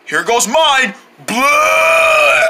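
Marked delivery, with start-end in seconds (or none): 1.3-2.4 loud fake vomiting sound; mock retching. Vomiting scene.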